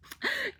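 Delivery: a sudden high squealing laugh; high squeal of laughter. Real, not acted. Fan remembering her fictional crush, squealing laugh. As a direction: squeal at the thought of him.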